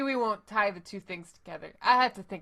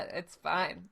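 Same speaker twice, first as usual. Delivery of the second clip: normal talking voice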